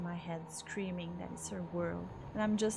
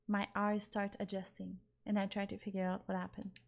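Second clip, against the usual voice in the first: ordinary talk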